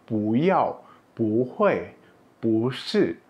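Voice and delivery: pu rises, then yao, hui, shi fall; second-tone pu. Shown as a demonstration. Teacher giving examples, every pu in rising second tone. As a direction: lift each pu like a question. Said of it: "dia dibaca dengan nada dua".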